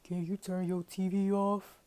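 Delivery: slow put-on voice, blurted from under covers; imitated roommate voice. Roommate in bed asking about the TV, mimicked voice. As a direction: mimic a roommate's odd, drawn-out request. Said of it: "I don't know why I made her voice like that".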